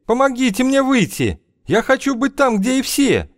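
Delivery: high, desperate shout; frantic yell. A narrator's reading. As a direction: scream to be found. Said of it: "он орал".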